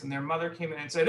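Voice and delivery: plain reading voice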